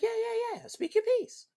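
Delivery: high, eager yeah yeah yeah; eager reply. Retold or imitated. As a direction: jump at the chance to hear her out. His eager reply to her.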